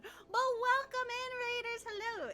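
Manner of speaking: high, bright streamer greeting; cute anime streamer. Real, not acted. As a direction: welcome the raiders, sing-song high. Greeting raiders.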